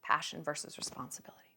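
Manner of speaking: low whispered refrain; hushed aside. Real, not acted. Comic naming the dilemma after a pause, hushed whisper. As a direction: let it sink in, whispered.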